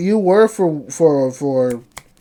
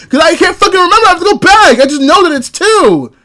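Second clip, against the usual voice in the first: higher, louder exasperated yell; real yelling; 9 semitones higher, 4 dB louder than usual. Real, not acted. Co-host yelling at his friend over who was wrong. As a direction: shout it out in frustration. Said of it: "I was yelling".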